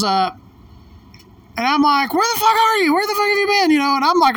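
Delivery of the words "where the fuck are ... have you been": high-pitched frantic demand; exasperated, high and insistent; all worked up. Retold or imitated. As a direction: re-enact a worked-up confrontation, voice jumping right up. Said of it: "all worked up".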